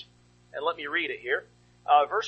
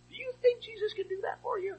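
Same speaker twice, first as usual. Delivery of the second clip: high, searching question; a lightly voiced Jesus asking the blind men; 4 dB quieter than usual. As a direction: ask it gently from up high.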